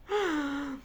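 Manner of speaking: real giggle trailing off; small laugh that won't be held back. Real, not acted. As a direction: giggle helplessly for a moment. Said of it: "impossible to laugh and look mean".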